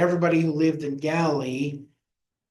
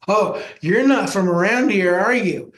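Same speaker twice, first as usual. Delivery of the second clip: light Georgia drawl, a touch higher and louder; 5 semitones higher, 6 dB louder than usual. Retold or imitated. Reuben Bennett comes in talking, light Georgia drawl. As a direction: tease it out in a hint of a Georgia drawl. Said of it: "starts talking like".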